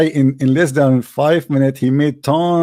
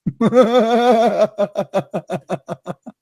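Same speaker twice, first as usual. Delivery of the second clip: long high laugh trailing away; helpless laughter; 4 semitones higher than usual. Real, not acted. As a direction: laugh until it peters out. Cannot stop laughing.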